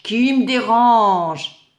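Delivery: grumpy sun. Acted. Sleepy sun wakes.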